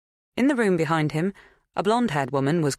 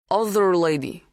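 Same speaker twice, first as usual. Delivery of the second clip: put-on higher Polish-accented statement; strong foreign accent; 4 semitones higher, 4 dB louder than usual. A narrator's reading. Polish farmhand recalling someone else, strongly accented. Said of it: "His accent was strong".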